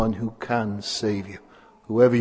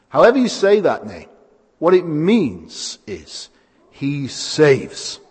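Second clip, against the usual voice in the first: normal talking voice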